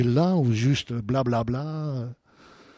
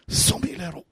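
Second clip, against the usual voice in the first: softly whispered, nearly voiceless echo; hushed repeat; 2 semitones higher, 6 dB quieter than usual. Retold or imitated. Pastor echoing the sum he asked God for, hushed. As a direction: let the sum out in a hush.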